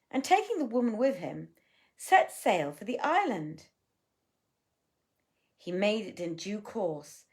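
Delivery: storybook reading voice; story narration. A narrator's reading. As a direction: read the old tale aloud. Reads a tale aloud.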